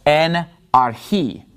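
slow "En archi", stress landing on "chi"; stress on last syllable. Shown as a demonstration. Teacher illustrating accent marks, slow final stress on "archi". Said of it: "the accented syllables".

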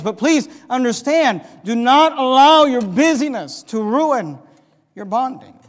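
forceful sermon appeal; urgent preaching. Acted. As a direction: urge the congregation hard.

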